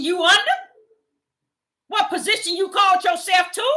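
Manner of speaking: high, loud scolding of the visiting pastor; defiant telling-off. Retold or imitated. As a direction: throw it in her face, no fear.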